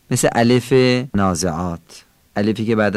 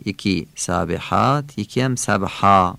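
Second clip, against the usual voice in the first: ordinary talk, nothing marked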